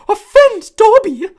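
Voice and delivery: high, loud, slow choking squeak; tears catching in the throat; choked-up elf. A narrator's reading. House elf overcome, choked high squeak. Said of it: "choked the elf".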